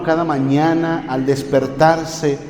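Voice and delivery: quiet intimate sermon voice; soft pastoral appeal. Acted. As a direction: draw the flock close with a hushed appeal. Softer, lower intimate exhortation.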